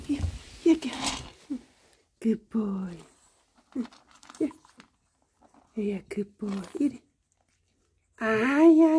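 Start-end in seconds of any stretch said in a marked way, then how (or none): 0.1-7.0 soft whispered coaxing. Real, not acted. Owner quietly coaxing pets to eat, whispered.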